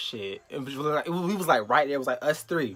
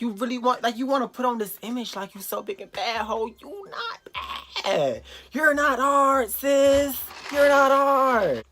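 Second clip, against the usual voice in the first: high, stretched-out mocking taunts; acted-out jeering, pitched way up; taunting tell-off; 9 semitones higher, 5 dB louder, slower than usual. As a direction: replay calling out a fake tough girl, building to loud drawn words. Replays taunting the girl in the quarrel.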